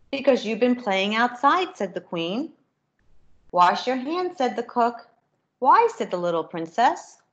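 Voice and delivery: lively storybook reading with character voices; reading to children. A narrator's reading. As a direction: read it brightly to a class of kids. Reads picture book to children.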